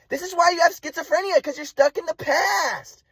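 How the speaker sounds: very high, drawn-out mock wail as mom; exasperated mother imitation. Mom cutting off son's explanation, exasperated high mock wail. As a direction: mimic mom's high-pitched exasperation, stretching the end. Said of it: "And my mom's like".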